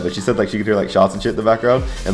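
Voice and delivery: ordinary delivery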